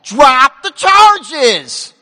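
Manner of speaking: every word of "drop the charges" hammered slowly; God's firm command, slow and emphatic; drop the charges, slow and loud. Retold or imitated. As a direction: lean hard on each word, drop the charges. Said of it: "what I heard is".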